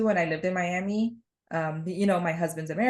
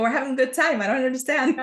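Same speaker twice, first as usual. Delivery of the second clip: higher-pitched, animated family protest; mock heated protest; 6 semitones higher, 4 dB louder, faster than usual. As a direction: protest loudly in mock outrage, as if arguing. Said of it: "like, screaming".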